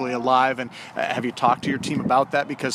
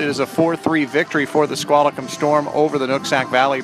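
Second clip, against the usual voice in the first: upbeat post-game host voice; bright on-field announcer delivery; sports broadcaster; 3 semitones higher, 5 dB louder than usual. Acted. Post-game play-by-play host wrap.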